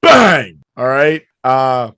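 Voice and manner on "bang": loud, high ring announcer call; announcer shout. Acted. Announcing wrestling champion.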